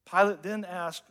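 ordinary delivery

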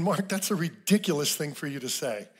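normal talking voice

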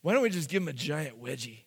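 hushed, mock-serious debating; mock deliberation. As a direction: talk it over quietly. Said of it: "they're like".

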